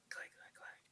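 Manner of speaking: soft unvoiced clicks; whispered clicks. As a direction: breathe the clicks out. Imitating VR finger clicks.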